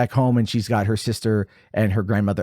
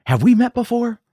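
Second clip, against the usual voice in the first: high, louder, emotional film-hero question; imitating hesitant lover; 5 dB louder than usual. Boy turns to the girl on the stairs, high urgent plea. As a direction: call it out high, acting his part. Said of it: "he turns around and he's all like".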